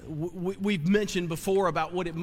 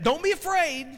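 much higher, louder ringing command; emphatic cry; 10 semitones higher, 3 dB louder than usual. Acted. Preacher crying the angel's words to frightened listeners, high and loud. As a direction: lift it and ring it out to the room.

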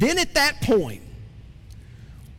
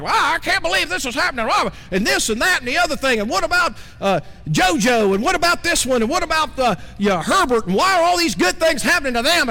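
mock venting rant; 6 semitones higher, faster than usual. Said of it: "You're like".

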